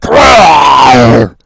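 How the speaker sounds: loud playful rawr; a hammed-up monster roar, very loud; mock fierce roar. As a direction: roar like a beast. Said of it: "they're like".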